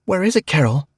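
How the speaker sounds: demanding. Acted. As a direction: insist on an answer, loud. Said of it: "I demanded".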